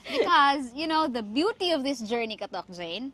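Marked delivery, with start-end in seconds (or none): none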